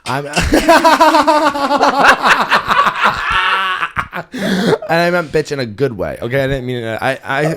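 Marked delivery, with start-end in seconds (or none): 0.3-4.3 long, high, loud burst of laughter; big cackle. Real, not acted. Host cracking up at their own insult to listeners, loud cackling laugh.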